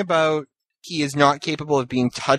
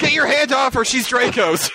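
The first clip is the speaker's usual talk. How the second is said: mock possessive shout; 9 semitones higher than usual. Mock jealous Draco voice.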